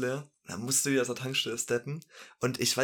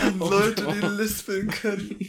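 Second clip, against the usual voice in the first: laughing; 9 semitones higher, 5 dB louder, slower than usual. Real, not acted. Laughs mid-sentence about lispers.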